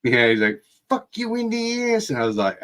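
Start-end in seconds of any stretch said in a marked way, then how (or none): 0.9-2.1 high, strained imitation of a drunk bully; imitating the uncle's crude catchphrase; mock hillbilly taunt. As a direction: ham up the bully's crude jeer.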